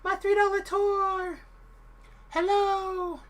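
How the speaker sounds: slow, high falsetto woman's voice; drawn-out high lady impression. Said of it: "That's my lady voice".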